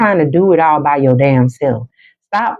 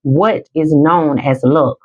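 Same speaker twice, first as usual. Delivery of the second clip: normal talking voice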